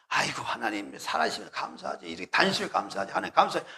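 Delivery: low, hushed voice of a thankful believer; softly grateful musing. Pretend. Preacher voicing a believer thanking God on a hot day, hushed. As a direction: murmur your thanks to yourself, half laughing.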